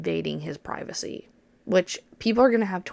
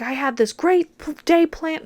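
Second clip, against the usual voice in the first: higher and louder indignant outburst; angry yell; 9 semitones higher, 5 dB louder than usual. Retold or imitated. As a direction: boyfriend yelling in the car, furious. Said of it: "so fucking pissed".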